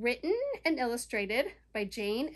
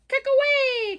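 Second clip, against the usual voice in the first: high, loud frog call; lightly voiced frog calling a warning; croaking frog; 11 semitones higher, 11 dB louder than usual. A narrator's reading. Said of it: "croaked Frog".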